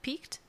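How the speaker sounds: "Peaked" in one syllable, "peekt", softly questioning; corrected pronunciation. Real, not acted. Host checking the correction, "Peaked" said as peekt, questioning.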